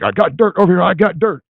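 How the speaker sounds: high excited mock call; retold excited call. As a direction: call out the find, pitched high. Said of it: "and he's like".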